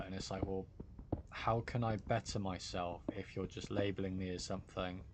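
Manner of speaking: normal talking voice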